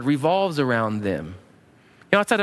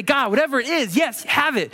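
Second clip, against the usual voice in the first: high-pitched willing offer; eager surrender; 9 semitones higher than usual. Acted. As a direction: hand it over gladly. Voicing willing surrender to God.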